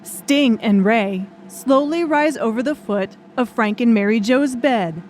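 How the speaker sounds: ordinary delivery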